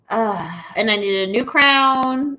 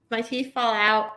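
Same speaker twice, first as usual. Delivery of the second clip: usual speaking voice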